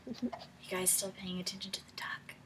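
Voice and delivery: soft unvoiced whisper. Real, not acted. Teacher whispering a question to pupils. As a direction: whisper it like a secret.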